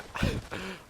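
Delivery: short high-pitched laugh; a brief, breathy burst of laughter; real quick high laugh. Real, not acted. Laughs at own sibling story.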